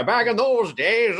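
put-on high, slow old-timer voice; twenties old man. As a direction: creak it out slowly like an old man. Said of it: "like a twenties old man".

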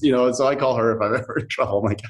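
ordinary talk, nothing marked

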